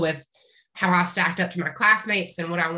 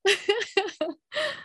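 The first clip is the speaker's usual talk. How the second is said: high-pitched rising giggle; giggle, caught off guard; high laugh; 13 semitones higher than usual. Real, not acted. Guest giggles before answering the host.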